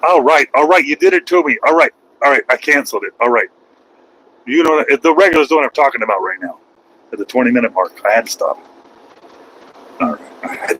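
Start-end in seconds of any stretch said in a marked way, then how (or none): none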